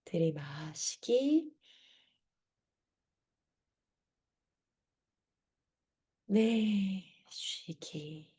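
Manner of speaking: soft, slow breathing guide; guided breathing cues. Shown as a demonstration. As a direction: cue each breath gently and slowly. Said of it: "사 초 한번 해볼게요".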